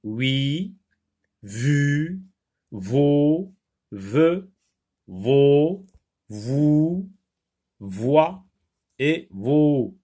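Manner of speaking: "Oui, vu, vaut" each alone, drawn out and rising; syllable drill. Shown as a demonstration. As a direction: read "Oui, vu, vaut" one by one, pausing, lifting each ending. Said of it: "on lit des syllabes contenant ces sons".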